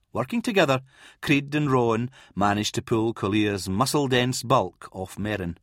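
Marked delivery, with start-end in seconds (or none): none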